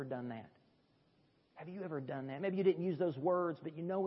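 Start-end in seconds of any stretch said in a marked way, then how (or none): 1.6-2.4 softer, hushed echo of the question; a quiet, personal second asking; softer repeat. Real, not acted. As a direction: ask it again gently and quietly. Repeated altar-call question.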